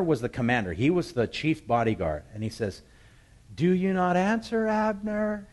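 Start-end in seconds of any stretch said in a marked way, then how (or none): 3.5-5.5 high, loud call carried across a distance; yelling out. Acted. David on a far hill calls to Abner, yelling. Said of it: "he begins to yell out".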